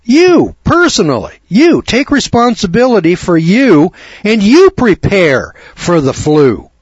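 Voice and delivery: high, loud punch on each YOU; forceful exhortation. Real, not acted. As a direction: drive it home to every single listener. Host drives the warning home.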